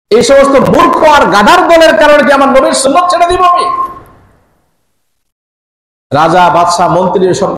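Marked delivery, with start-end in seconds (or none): 0.1-3.6 high, impassioned rebuke; acted-out indignant cry; retold defiant shout. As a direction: throw it at the whole court, pitched high. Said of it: "চিৎকার দিয়ে বলেছেন".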